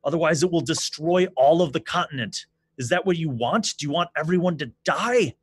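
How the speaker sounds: urgent king pleading. Acted. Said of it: "he's like".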